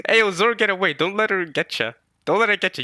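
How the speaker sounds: loud, urgent warning call. Real, not acted. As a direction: shout a warning across the room. Calling out to Zoro.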